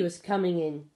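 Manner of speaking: plain reading voice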